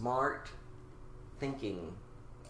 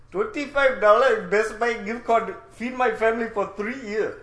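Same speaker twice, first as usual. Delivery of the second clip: loud, higher put-on Indian accent; 5 semitones higher, 5 dB louder than usual. Scammer demanding gift cards to feed the family, Indian accent. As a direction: push out a comic Indian accent, raised and loud. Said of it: "get my Indian and Australian".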